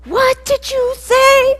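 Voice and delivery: high, loud, slow furious demand; furious mom. Retold or imitated. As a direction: glare and demand it like an angry mother. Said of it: "put her hands on my shoulders and said".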